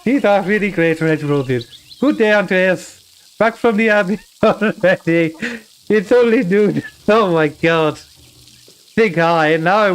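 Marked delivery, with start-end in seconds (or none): 0.0-6.8 put-on higher-pitched, wobbly German accent; attempted German accent. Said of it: "to do a German accent".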